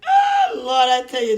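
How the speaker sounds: sudden high, loud cry of Oh Lord; outcry to the Lord. Real, not acted. Man bursting out to the Lord after his story, loud high cry.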